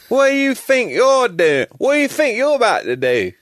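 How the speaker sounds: hammed-up angry Brit scolding; angry British accent. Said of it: "British accents when they say".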